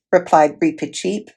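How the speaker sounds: plain reading voice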